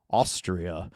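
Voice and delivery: low, quiet, understated reply; woman's answer. Retold or imitated. Host retelling the woman's answer in Dumb and Dumber, low and understated. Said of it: "And she says".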